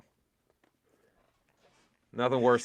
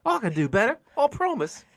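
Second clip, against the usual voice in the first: high falsetto pepperpot voice, louder; Monty Python lady; 11 semitones higher, 3 dB louder than usual. Retold or imitated. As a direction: screech it like a Python housewife. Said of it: "made her like every female Monty Python character".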